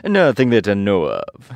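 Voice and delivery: loud, slow, falling reply; lightly voiced woodman, drawn out. A narrator's reading. Woodman declining the mouse's offer, loud drawn-out reply.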